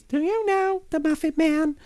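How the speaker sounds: very high, squeaky little voice; high-pitched character voice. Shown as a demonstration. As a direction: pitch it right up into a tiny cartoon voice. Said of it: "high-pitched voices".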